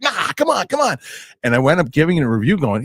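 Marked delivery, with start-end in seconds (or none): 0.0-1.0 much higher coaxing plea. Retold or imitated. Friend coaxing him to admit he liked it, high and pleading. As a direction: wheedle him, mock-insistent. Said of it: "He's like".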